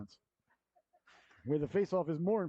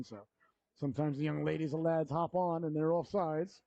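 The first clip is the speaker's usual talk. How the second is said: ordinary delivery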